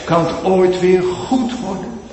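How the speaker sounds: loud, high pleading sermon voice; fervent preaching. Acted. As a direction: cry it out as a desperate sinner. Preaching at full force. (luid en smekend gepreekt)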